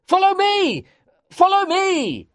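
very high-pitched, excited calling out; joyful shout. A narrator's reading. Narrator calling companions back into the cave, excited shout. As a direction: call the others after you. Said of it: "I shouted".

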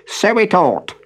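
high, scowling little man. A narrator's reading. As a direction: grumble it with a scowl. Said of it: "with a scowl".

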